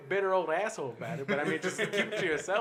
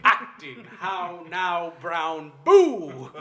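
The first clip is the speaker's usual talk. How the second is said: hammy theatrical actor; 9 dB louder, much slower than usual. Retold or imitated. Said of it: "thespian actors trying to scare you".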